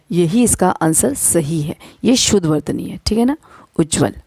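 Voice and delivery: ordinary talk, nothing marked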